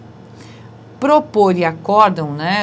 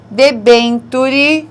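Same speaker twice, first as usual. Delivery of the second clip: "Debenture" slow, loud and higher; emphasized word; 5 semitones higher, 6 dB louder, much slower than usual. Shown as a demonstration. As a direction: drag out "Debenture". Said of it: "está certo". ("Debenture" lento e forte)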